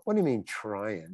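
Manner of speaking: normal talking voice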